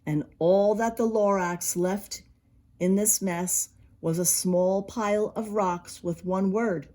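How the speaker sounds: usual speaking voice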